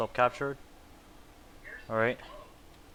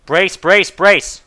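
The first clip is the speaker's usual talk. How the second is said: crew shouting brace; 6 semitones higher, 10 dB louder, much slower than usual. Acted. Pilot calls brace before landing.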